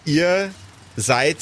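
ordinary talk

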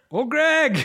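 creepy comedy character voice; Old Greg voice. Retold or imitated. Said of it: "my daughter's favorite thing".

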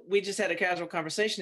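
ordinary talk, nothing marked

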